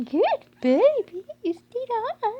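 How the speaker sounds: drawn-out loud praise; gushing praise again. Real, not acted. Owner repeating the praise to the puppy, loud and slow. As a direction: keep the praise going, slow.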